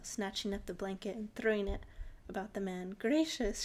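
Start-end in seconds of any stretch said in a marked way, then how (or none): 3.0-3.6 louder, high shocked exclamation; gasped. Acted. Girl seized by the porcelain man, loud gasp. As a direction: cry it out in alarm, pitched up. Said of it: "she gasped again".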